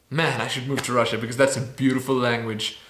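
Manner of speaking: usual speaking voice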